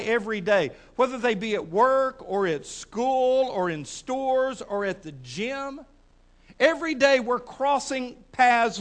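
loud, deliberate preaching with drawn-out phrases; emphatic preaching. Real, not acted. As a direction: press each phrase on the congregation. Preaches to congregation.

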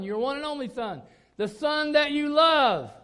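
"the son that you love" drawn out slow, loud and high; booming, slowed command. Acted. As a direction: thunder God's words, stretching "love". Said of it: "That's God's words".

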